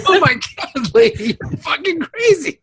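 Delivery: cracking up at the absurd come-on; laughing shout. Real, not acted. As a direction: cry out in disbelief, still laughing.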